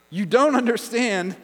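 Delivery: ordinary talk, nothing marked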